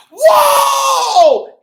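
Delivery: high, loud drawn-out whoa; big surprised whoa. Acted. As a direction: gasp out a long surprised whoa. Surprise at teacher in story.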